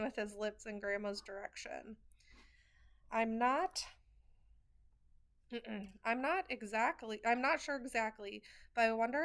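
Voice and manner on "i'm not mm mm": plain reading voice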